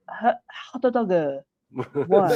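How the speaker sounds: slow "hot doga" with a final a and a put-on voice; imitating Japanese tourist. Imitating a Japanese tourist ordering at the Disneyland window, put-on accent. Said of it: "Japanese tourists come up to your window and order".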